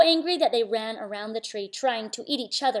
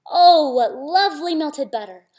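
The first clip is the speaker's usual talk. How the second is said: loud, higher exclamation; delighted; 4 semitones higher, 10 dB louder than usual. Acted. Bappa finding what is left of the tigers, loud delight.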